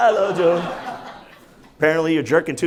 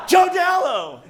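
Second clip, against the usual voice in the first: high, falling shout of the name; hammed-up, high-pitched holler; mock calling out; 10 semitones higher, slower than usual. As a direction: yell the full name as if summoning someone. Acts out people calling his name.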